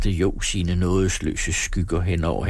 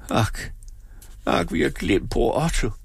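mild plaintive moan; understated wail; lamenting; 2 semitones higher than usual. A narrator's reading. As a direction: lament it with a sigh of guilt. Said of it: "klagede hun".

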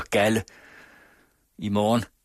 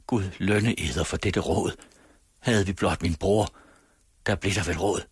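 ordinary delivery, nothing marked

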